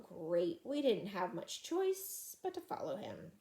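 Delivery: ordinary delivery, nothing marked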